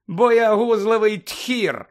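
high, loud, drawn-out taunt; biting jibe. A narrator's reading. Hermione throwing an insult after Malfoy, caustic. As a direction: fling the insult slowly and loudly. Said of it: "уїдливо кинула Герміона".

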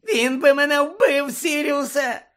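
very high, loud, squealing plea; pitiful high-pitched whimper; whining Pettigrew. Acted. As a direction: wail it in a squeaky, terrified voice. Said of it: "заскиглив Петтігру".

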